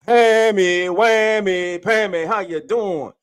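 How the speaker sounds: high, loud drawn-out roll call; hype greeting. Real, not acted. Host welcomes Pammy to the show, loud hyped call. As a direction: sing out the name in welcome. Host calls out arriving viewer.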